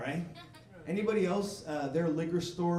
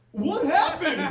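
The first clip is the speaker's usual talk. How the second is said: slow, high, loud "What happened?"; baffled customer's slow, loud what happened; drawn-out question; 11 semitones higher, 10 dB louder, slower than usual. Retold or imitated. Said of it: "he asks me".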